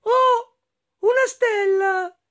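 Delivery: high, loud drawn-out cry of wonder; amazed exclamation. Acted. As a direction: call out in astonishment at a star. Hedgehog exclaims at a star. (esclamazione di meraviglia, acuta e forte)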